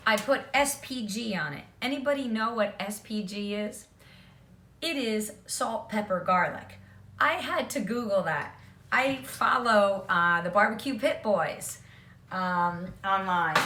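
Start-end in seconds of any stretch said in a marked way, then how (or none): none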